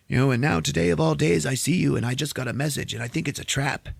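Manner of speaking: high, paranoid character voice; suspicious old rebel. Retold or imitated.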